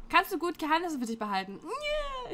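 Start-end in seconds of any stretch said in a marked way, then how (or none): 1.6-2.3 high no. Real, not acted. Quick answer to a quiz question.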